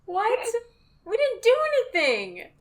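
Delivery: high-pitched, put-on innocence; indignant protest. Player answering the storekeeper, high mock protest.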